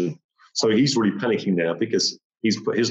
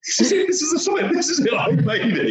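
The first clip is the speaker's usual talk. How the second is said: much higher, elated cry. Retold or imitated. Climber on the summit cries out in elation. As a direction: shout your triumph. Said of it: "I'm like".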